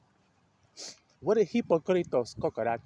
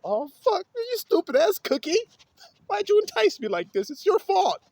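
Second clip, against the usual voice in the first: put-on high squeaky whiny kid voice, much higher; kid cussing the cookie. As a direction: pitch way up like a busted kid blaming the cookie. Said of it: "You're like".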